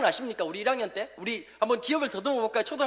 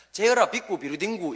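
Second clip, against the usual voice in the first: put-on old teacher's Japanese-style English, every syllable spelled out; Japanese-accented English; 2 semitones lower than usual. Preacher mimics his old teacher's Japanese-accented English. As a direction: say it the way a Japanese-trained teacher would. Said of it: "뭐라고 발음하셨냐니까".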